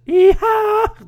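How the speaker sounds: very high, loud mangled yeehaw; Borat-like yeehaw. Retold or imitated. Host trying to yell yeehaw, in a high Borat accent. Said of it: "she was trying to say yeehaw".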